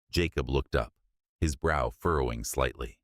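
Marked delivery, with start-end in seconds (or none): none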